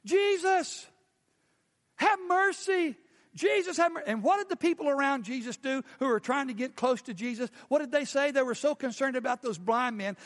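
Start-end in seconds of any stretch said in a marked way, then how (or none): 0.0-4.1 high, loud desperate call. Retold or imitated. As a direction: shout across the crowd to Jesus. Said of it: "they began to call out".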